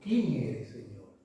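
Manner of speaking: asked in awe, almost fading away; prostrate, fearful. A narrator's reading. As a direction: ask it low from the ground, barely audible.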